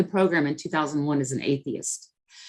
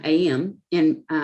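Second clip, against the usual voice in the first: ordinary talk, nothing marked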